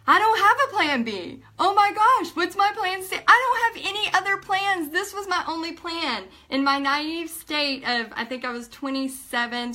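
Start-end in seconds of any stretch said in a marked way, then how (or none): 0.1-6.2 high, loud acted panic; panicked. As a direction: blurt it out in mock panic, voice pitched way up. Acts out panic at bar.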